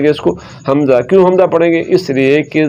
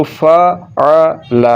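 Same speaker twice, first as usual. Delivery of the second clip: फ़अल drawn out slowly in separate sounds; letter by letter; much slower than usual. Shown as a demonstration. Teacher easing the reading for pupils, slow separated letters. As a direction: split फ़अल into fa, a, la. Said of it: "अकेले हरफ को अगर आप पढ़ेंगे".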